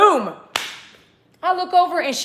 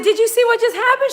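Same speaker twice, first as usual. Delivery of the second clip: high, fast and louder; excited rapid telling; 11 semitones higher, 6 dB louder than usual. Retold or imitated. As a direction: spill it out excitedly to the lady. Excited question to lady.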